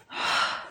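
aroused exhale; voiceless sigh of pleasure; breathy sigh. Acted. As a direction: sigh out heavily on breath alone.

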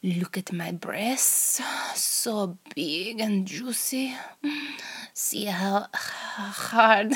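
whispered Russian spy interrogator; hammed-up Russian accent, hushed start; over-the-top Russian accent. Interrogator taunting the captured American spy in a Russian accent. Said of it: "in a Russian voice".

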